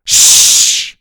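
loud, sharp hushing sound; urgent shush. A narrator's reading. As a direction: shush him at once. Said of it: "Grandpa and Grandma hushed him".

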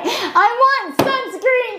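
put-on high, very slow childish whine; kid whine. Son whining to mom for sunscreen, high drawn-out kid voice. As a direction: stretch it out like a begging little boy.

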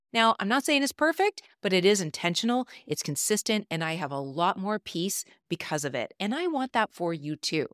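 upbeat promo read. Acted. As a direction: pitch it like a radio spot. Recorded promo for her program.

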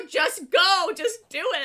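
loud, high burst of impatience; impatient fan cheering them on; impatient urging. Acted. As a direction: egg them on, exasperated. Urges on the book's hero.

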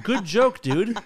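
sarcastic compliment with a laugh. Retold or imitated. To the fan joking about cancer, sarcastic laugh. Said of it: "I'm like".

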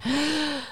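a quick, sharp gasp of mock shock. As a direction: gasp like you can't believe what you're seeing. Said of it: "I'm just going".

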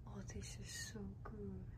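whisper. Real, not acted. Tasting, whispered.

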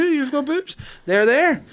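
put-on high, loud little kid insisting; squeaky, emphatic child's voice; insistent child. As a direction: mimic a child pointing out the obvious.

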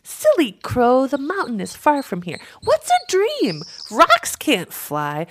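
high, loud bird voices; chattering swifts. A narrator's reading. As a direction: chatter dismissively like silly little birds. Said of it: "the swifts began chattering".

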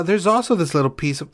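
normal talking voice

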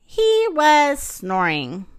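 ordinary delivery

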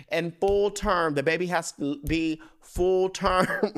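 slow, emphatic full term; insistent. Retold or imitated. Daughter insisting to mom, slow emphatic words. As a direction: lean hard on full term.

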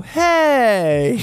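high, loud, drawn-out "hey"; mock-casual hey. Podcaster imitating Emilio's greeting in the film, mock-casual holler. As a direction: call out a breezy hey, stretched and high. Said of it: "Emilio gives out this casual".

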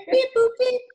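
high, loud beeping alien. Pretend. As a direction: chirp it like a little alien. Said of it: "Put, put your ears on one more time".

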